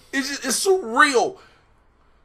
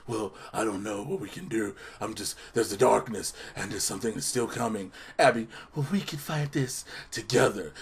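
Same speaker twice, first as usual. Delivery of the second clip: much lower and softer monster voice; low muttering Swamp Thing; 11 semitones lower, 13 dB quieter than usual. Retold or imitated. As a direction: brood low and quiet.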